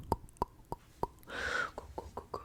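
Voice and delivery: usual speaking voice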